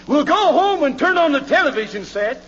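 ordinary delivery

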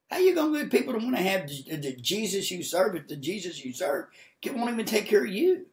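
very high, loud impassioned preaching; full-force preaching. Real, not acted. Preacher challenging believers about representing God, full-force fervour. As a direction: cry it out to the congregation at peak intensity.

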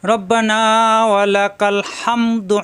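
drawn-out chanted dua; measured tajweed chant; slow Quranic recitation. Shown as a demonstration. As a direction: recite it slowly and melodically.

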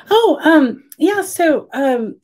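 high, flustered stammering; flustered stammer after a sudden love confession; nervous fluster. Retold or imitated. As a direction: stammer it out, caught off guard and nervous. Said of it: "I was just all nervous".

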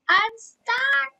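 very high, loud cry of dismay; real high-pitched yelp; loud wail. Real, not acted. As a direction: yell it out high and helpless. Yells it again, then apologises for neighbors.

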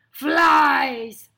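very loud, scornful outburst; lion's roar. Acted. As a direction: bellow it as an outraged lion. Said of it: "roared Lionel".

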